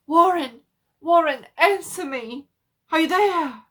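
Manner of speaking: loud, high frantic calls; desperate calling. A narrator's reading. Carter calls into the telephone for Warren, frantic. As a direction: yell down the line for him.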